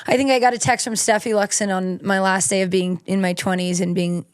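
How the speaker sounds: usual speaking voice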